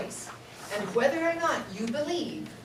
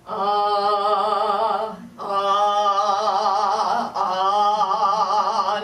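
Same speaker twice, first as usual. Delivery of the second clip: deep chesty ah, held out; low register ah; 6 dB louder, much slower than usual. Shown as a demonstration. Voice teacher demonstrating the low register, deep ah. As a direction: sing a low, open ah. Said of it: "It sounds something like this".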